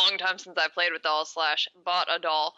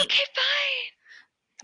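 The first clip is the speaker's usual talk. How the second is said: whisper; much slower than usual. Acted. Playful mock reluctance.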